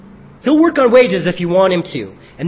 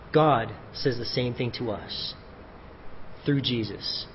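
much softer and lower closing appeal; tender sincerity; 5 semitones lower, 11 dB quieter than usual. Real, not acted. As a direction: quietly invite intimacy with God.

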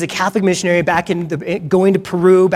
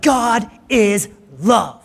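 God is love stretched out high, half-hearted yell; understated De Niro-style cry; higher, slow mock yell; 4 semitones higher, much slower than usual. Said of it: "he yells out".

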